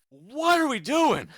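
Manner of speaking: high, drawn-out incredulous plea; exasperated, wailing question; exasperated disbelief. Real, not acted. Exasperated at the chaps segment.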